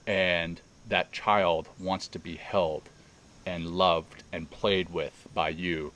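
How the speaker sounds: very slow, spaced-out phrasing; lingering, deliberate quote; retold guided visualization. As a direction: draw each phrase out as you retell the guide.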